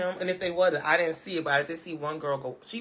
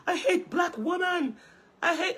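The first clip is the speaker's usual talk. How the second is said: mocking impression; 13 semitones higher than usual. Vlogger mocking Carrot Top's rant, high mocking voice. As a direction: parody an angry man ranting. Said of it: "You see how I'm making fun of him now?".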